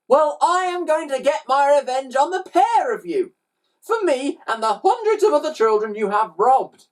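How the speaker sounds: high, loud vow of revenge; enraged boy. A narrator's reading. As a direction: declare it as a furious child, pitched right up. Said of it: "The boy became enraged".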